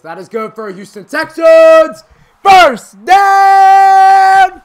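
booming, high shout with hugely stretched final words; stadium announcer. Retold or imitated. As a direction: imitate an announcer rousing a whole stadium. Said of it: "The announcer goes".

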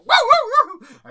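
very high, very loud yelping barks; dog barks. Acted. Dog pecked on its tail, loud high yelps. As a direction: yelp like a dog nipped on the tail.

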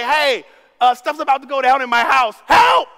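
loud, rising panicked plea for rescue; mock call for help. Child calling a relative, hammed-up loud cry for help. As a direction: yell it like the house is about to blow.

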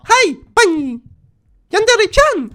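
very high, loud squealed greeting; imagined senpai greeting. Acted. Senpai finally notices Yandere-chan, hammed-up squealed greeting. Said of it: "tak powiedział".

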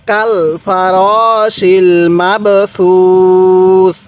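very slow, drawn-out Quran reading; teacher reciting slowly for students to repeat; slow recitation. Shown as a demonstration. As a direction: recite each syllable slowly for students to copy. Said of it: "আরেকবার পড়ুন".

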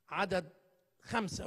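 usual speaking voice

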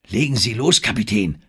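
lauter, gehobener Zuruf. A narrator's reading. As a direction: rufe es dem Kapitän auffordernd zu. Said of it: "rief der Regisseur Engelhorn zu". (auffordernder Ruf des Regisseurs)

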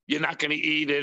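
usual speaking voice

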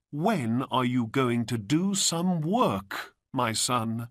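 high, exasperated mother's lament; scolding mother. A narrator's reading. Mother to her lazy boy, scolding.

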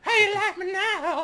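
very high, loud, drawn-out taunt; triumphant yell. Real, not acted. Player taunting the game boss, triumphant yell. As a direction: crow it at the top of your voice.

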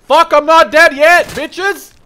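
loud, high, slow defiant roar; gleeful high-pitched taunting shout; triumphant yell. Real, not acted. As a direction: scream your survival at the enemy. Survives near death, taunting.

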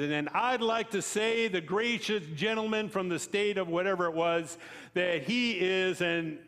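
pitched well up, sarcastically polite; a congressman mockingly polite before the jab; mock formal insult. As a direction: sneer the courtesy while building to the insult. Retold heated House floor insult.

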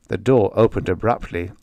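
ordinary delivery, nothing marked

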